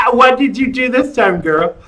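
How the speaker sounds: ordinary delivery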